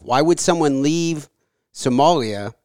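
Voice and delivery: ordinary talk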